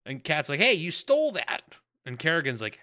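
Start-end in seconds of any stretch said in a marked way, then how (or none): 0.6-1.8 high, indignant yelp; mock outrage. Kat accusing Dibs of stealing, high indignant yelp. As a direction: squeak it like she caught a thief.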